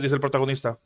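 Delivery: usual speaking voice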